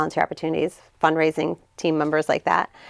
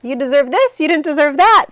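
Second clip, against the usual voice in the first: higher and louder, insisting warmly; emphatic reassurance; 9 semitones higher, 6 dB louder than usual. Retold or imitated. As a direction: tell her firmly she deserves it. Reassuring girl she deserves help.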